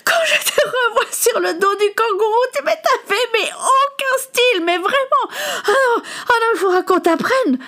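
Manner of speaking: high, laughing through the words; barely able to talk for laughing. A narrator's reading. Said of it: "Son fou rire gagna Elisabeth".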